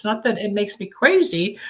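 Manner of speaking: usual speaking voice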